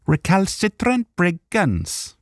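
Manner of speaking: high, slow Welsh elf; Welsh accent. Acted. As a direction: sing it out in a lilting Welsh accent. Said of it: "I'm from Wales".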